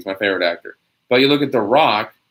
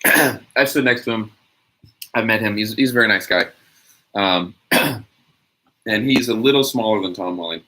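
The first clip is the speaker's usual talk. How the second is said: ordinary talk, nothing marked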